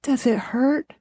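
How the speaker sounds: a lightly voiced worried heroine; tender concern. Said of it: "she's like".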